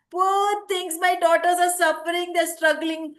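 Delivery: high, loud self-pitying moan; cling and complain loudly; loud lament. Acted. Latching onto a passerby, she moans loudly and high.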